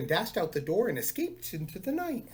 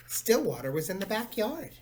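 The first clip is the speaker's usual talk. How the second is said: plain reading voice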